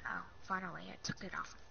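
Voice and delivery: whispered to self; whispering. Real, not acted. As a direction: breathe it out in relief. Relief under breath.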